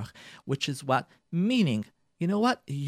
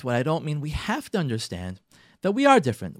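normal talking voice

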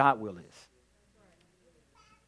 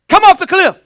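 much higher and louder, an alarmed yell; urgent shout; 19 dB louder than usual. Shown as a demonstration. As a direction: shout it like a life depends on it. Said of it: "you're gonna hear the urgency of my voice".

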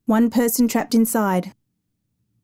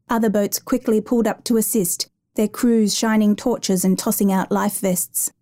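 usual speaking voice

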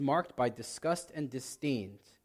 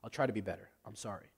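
lower, softer, contrite apology; sincere apology; 6 semitones lower, 4 dB quieter than usual. Pretend. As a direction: apologize gently to your partner. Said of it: "Instead says".

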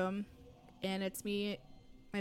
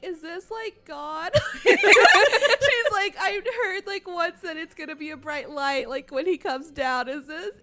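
higher, laughing through the quoted question; imitating mom, laughing; 7 semitones higher, 10 dB louder, slower than usual. Retelling friend's mom wondering if it's God, laughing. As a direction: retell the mom's awed guess while cracking up. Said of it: "her mom being like".

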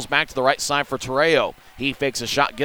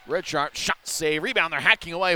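ordinary delivery

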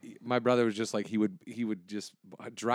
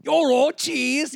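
exaggerated fake British accent, much higher, louder and slow; terrible British impression; 9 dB louder, much slower than usual. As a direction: ham up a British accent badly. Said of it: "a terrible blown out impression".

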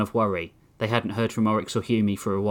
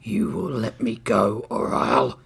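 growled threat; slower than usual. Acted. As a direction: growl it at your captors. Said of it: "Oryx growled".

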